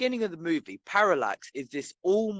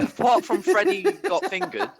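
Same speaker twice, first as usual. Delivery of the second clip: words squeezed out through laughter; giggling while getting it out; laughing; 12 semitones higher, 3 dB louder than usual. Real, not acted.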